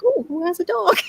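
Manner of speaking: high, cooing delight; gushing over a dog. Retold or imitated. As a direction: coo as if spotting a cute dog.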